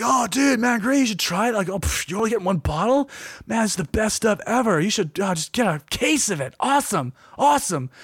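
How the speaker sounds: high, peppy salesman voice, lightly done; imitating pushy promoters. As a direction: hype the drink like an overeager promoter. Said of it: "a lot more energetic and enthusiastic".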